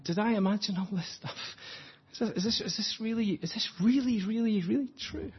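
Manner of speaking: high, hushed self-questioning; doubting inner voice. Acted. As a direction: stumble over the repeats as doubt creeps in. Acted anxious inner doubt on journey.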